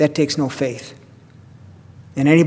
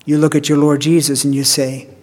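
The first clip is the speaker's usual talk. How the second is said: normal talking voice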